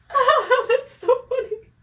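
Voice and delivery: high, giggling reaction; still laughing. Retold or imitated. Mother reacting to son's supposed joke, still laughing. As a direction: laugh it out, thoroughly tickled. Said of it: "I'm, like, still laughing".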